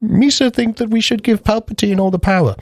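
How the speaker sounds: high silly Gungan voice; squeaky alien babble; Jar Jar voice. Retold or imitated. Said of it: "He goes to, like".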